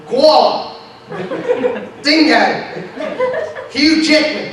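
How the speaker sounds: Australian words called out high, one at a time; mock Australian accent. Comic showing off fluent Australian to the audience, mock Australian accent. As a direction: punch each word in a hammed-up Australian twang. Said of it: "I speak fluent Australian".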